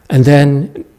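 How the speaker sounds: normal talking voice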